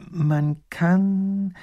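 slow pondering start; slow and thoughtful. Acted. Professor ponders aloud before the duke, slowly. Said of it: "langsam und überlegend".

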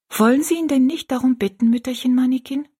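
put-on high, artless girl's voice; simple child's question. A narrator's reading. Rosalie urging the old woman to pray, simple high-voiced question. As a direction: ask it simply, pitched well up. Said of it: "fragte Rosalie einfach".

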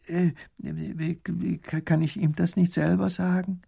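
high, halting, timid question; flustered stammer. A narrator's reading. Woman dares question the minister, stammering. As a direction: stumble into the question shyly.

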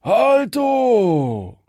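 high, loud call from the other side; shouted halt. Acted. Second bandit shouting halt from behind. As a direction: yell it as a second ambush. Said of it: "da schallte es auch von dort".

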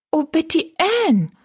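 put-on high, dismayed "Oh" of a shocked girl; dismayed cry. Rosalie hears that her father will remarry, crying out in dismay. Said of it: "rief Rosalie bestürzt".

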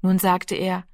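ordinary delivery, nothing marked

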